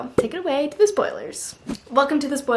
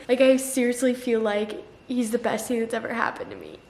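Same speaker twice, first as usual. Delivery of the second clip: ordinary talk, nothing marked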